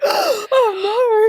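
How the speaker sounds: high, hammed-up horror; mock gasp oh no. The class pretending to be shocked, high.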